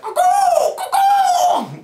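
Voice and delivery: loud, very high cuckoo call; cuckoo bird call. Shown as a demonstration. Player demonstrating a bird-call signal, shrill cuckoo. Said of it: "a noise".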